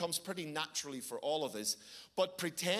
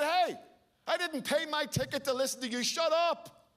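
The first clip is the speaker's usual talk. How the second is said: high, loud irate yelling, building to the last words; retold red-mist shouting; angry outburst; 9 semitones higher, 6 dB louder than usual. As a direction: tell off noisy strangers at full voice. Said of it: "the red mist came down".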